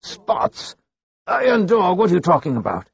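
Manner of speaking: he has no idea what the priest means; baffled outburst. A narrator's reading. Thief baffled by the priest's words, high confused outburst.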